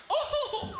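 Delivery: sudden very high "Oh!"; mock surprise. Comic's contestant spots the letter, squeals very high. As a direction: squeal with delight like a dim contestant.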